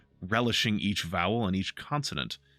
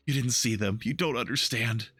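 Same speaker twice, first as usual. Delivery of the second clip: normal talking voice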